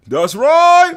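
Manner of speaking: loud, high "that's right" holler; shouted catchphrase. Acted.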